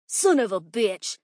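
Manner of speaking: angry cry. A narrator's reading. Teacher curses as his pills spill, crying out. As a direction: curse out loud in annoyance. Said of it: "cried Mister Bernard".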